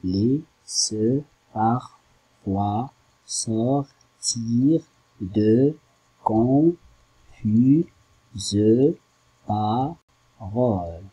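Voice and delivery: each syllable of "Les, se, par, quoi, sortir, de, confuse, parole" set apart with pauses, slowly; slow syllable-by-syllable scansion; counting syllables aloud. Shown as a demonstration. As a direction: tap out every syllable of "confuse" and "parole" one by one. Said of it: "en combien de syllabes?".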